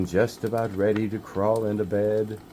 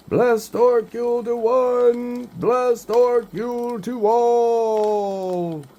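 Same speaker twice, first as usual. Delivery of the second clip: much higher, louder, slow and drawn out like a howl; howling wolf call; 7 dB louder, slower than usual. Acted. Odin calling from the wind, a long wolf howl. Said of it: "like a howling wolf's call".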